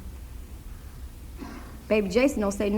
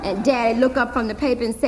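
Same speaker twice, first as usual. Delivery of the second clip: steady storytelling voice; story narration; faster than usual. A narrator's reading. Narrator Hazel telling listeners her story, steady storytelling voice. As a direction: tell it plainly as the grown-up Hazel.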